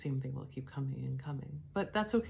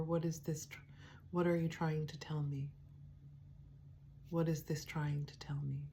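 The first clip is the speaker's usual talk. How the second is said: gentle self-questioning; 6 dB quieter than usual. Shown as a demonstration. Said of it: "I can just say".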